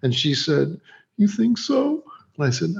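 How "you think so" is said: much higher, hopeful imitated question; imitating hopeful actress. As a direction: ask for reassurance in a high mock voice. Retold actress fishing for reassurance.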